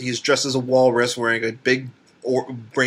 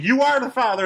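talk show host; 9 semitones higher than usual. Retold or imitated. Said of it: "he's like".

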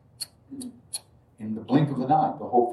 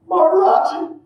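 much higher, louder blissful cry of the name; ecstatic answer; 6 dB louder than usual. Retold or imitated. Narrator answers the old devotee, loud ecstatic name. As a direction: answer in rapture. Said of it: "I was like".

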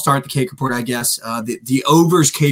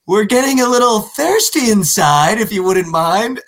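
put-on higher, overly polite drunk voice; mock-dainty drunk request, pitched up; drunk buddy; 8 semitones higher, slower than usual. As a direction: sweetly ask the bartender for a refill, sloshed and too formal. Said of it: "And I just hear him go".

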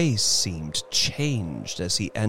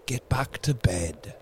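unsteady; 2 semitones higher, 4 dB quieter than usual. Acted. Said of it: "he said unsteadily".

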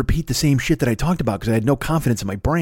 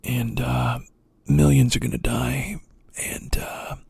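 low, hushed, solemn and grave; mock-serious emergency broadcast, breathy and low; Michael Moore impression; 3 semitones lower, 6 dB quieter than usual. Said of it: "I'm even doing it with more passion than he did".

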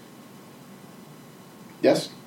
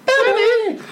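excited shout. Real, not acted. Excited shout of actor's name.

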